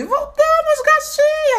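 excited squeal. Real, not acted. Return of show, squealed greeting.

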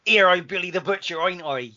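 loud, high, hammed-up mock Cockney; badly faked Cockney, straying into Australian; bad Cockney accent. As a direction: do Billy Butcher in terrible Cockney. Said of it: "the kind of Cockney one that's not".

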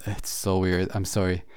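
ordinary talk

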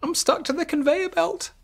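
squeaky panicked plea; distressed carrot. Acted. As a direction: wail it like a frightened tiny carrot. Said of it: "cried Carrot".

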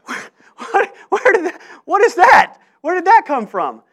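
high, loud, bewildered outburst; hammed-up alarm at what just slipped out; mock shock. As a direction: yelp it, aghast at your own words. Acts shock at his own words.

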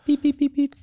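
high-pitched, even run of beeps; imitated electronic beeping. Imitating the bug-detecting shaver, high electronic beeps. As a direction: mimic a little gadget bleeping.

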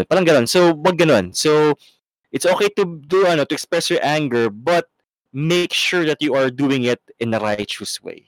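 ordinary talk, nothing marked

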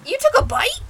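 high, loud incredulous question; acted shock. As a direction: shoot up in pitch, appalled. Player answers crocodile's boast in scene.

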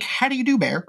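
high-pitched mock genteel greeting; overly polite. Joking about greeting a bear like a guest, overly polite. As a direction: play it daintily courteous. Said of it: "'cause you were polite".

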